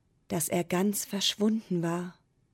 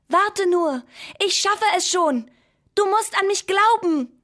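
much higher, louder urgent call; 12 semitones higher, 8 dB louder than usual. A narrator's reading. Pencil calling up to the sad moon, high and loud. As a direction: shout up with stubborn hope. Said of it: "Aber der Bleistift rief".

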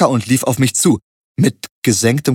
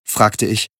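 ordinary delivery, nothing marked

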